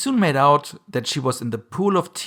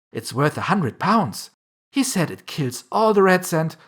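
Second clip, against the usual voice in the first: put-on higher-pitched gushing girl; eager; 6 semitones higher, 4 dB louder than usual. A narrator's reading. Alice tells the mouse about a neighbour's dog, eagerly. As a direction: chatter on keenly, pitched up. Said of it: "so Alice went on eagerly".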